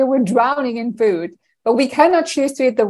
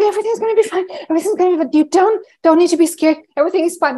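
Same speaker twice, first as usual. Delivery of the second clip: much higher, cooing reassurance; soothing a dog; 7 semitones higher, much faster than usual. Pretend. Owner reassuring the scared dog on New Year's Eve in a high cooing voice. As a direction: coo at a frightened dog to calm it.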